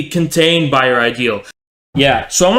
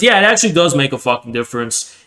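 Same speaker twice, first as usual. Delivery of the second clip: normal talking voice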